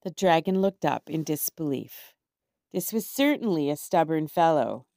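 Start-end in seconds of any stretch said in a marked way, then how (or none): none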